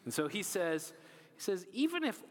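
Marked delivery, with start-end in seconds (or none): 1.7-2.2 a touch of snide boast. Retold or imitated. Peter boasting to Jesus, mildly snide. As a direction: lightly brush off the warning. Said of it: "so snide and rude".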